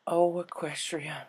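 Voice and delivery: a shaken, disbelieving breath. Acted. Applejack sees Rainbow's injuries, slow and stunned. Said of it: "she said disbelievingly".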